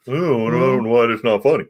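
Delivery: hint of a grumbling old man; put-on old man mumble. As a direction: lightly mimic an old man not getting it. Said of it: "it's just the old man going".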